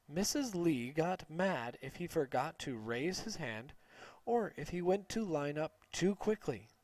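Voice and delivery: ordinary delivery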